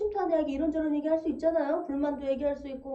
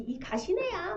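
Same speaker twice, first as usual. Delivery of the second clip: Gyeongsang dialect; 5 semitones higher, 4 dB quieter, slower than usual. Shown as a demonstration. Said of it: "경상도 사투리가 보면".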